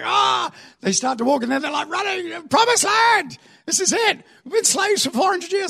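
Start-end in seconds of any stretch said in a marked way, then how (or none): 0.0-0.5 high-pitched crowd cheer; excited cry. Acted. Israelites nearing the promised land, excited cry. 1.9-5.7 high-pitched jubilant whooping; excited shouting. Acted. Freed slaves reaching the promised land, excited shouting.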